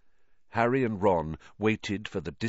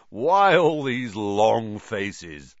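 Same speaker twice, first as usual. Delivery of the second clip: slow, louder, jolly teacher voice; breezy teacher mocking their gloom; cheery Lockhart; 2 semitones higher, 6 dB louder, much slower than usual. A narrator's reading.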